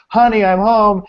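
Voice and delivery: high, loud announced arrival; homecoming call. Retold or imitated. Husband re-entering to greet his wife, calling out bigger. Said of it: "comes back in and goes".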